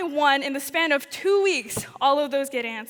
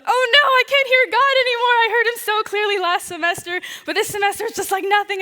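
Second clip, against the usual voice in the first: higher and louder, a panicked rush; retelling her panic that she can't hear God, voice pitched up in alarm; 6 semitones higher, 6 dB louder than usual. Retold or imitated. Student missionary retelling her panic at hearing nothing, voice raised in alarm. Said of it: "I started freaking out".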